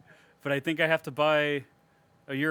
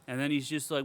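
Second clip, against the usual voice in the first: usual speaking voice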